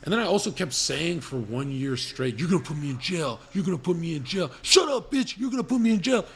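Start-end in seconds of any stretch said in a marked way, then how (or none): 2.4-6.2 building from raised voice to high loud yell; re-enacted rant escalating into a high scream; retold screaming. He re-enacts yelling at Jill in hotels, screaming. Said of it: "'cause I'd be screaming".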